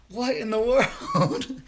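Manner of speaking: high, laughing what in the world; laughing disbelief. Retold or imitated. Dreamer laughing at her own bizarre dream, high and amused. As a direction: break into a laugh mid-question.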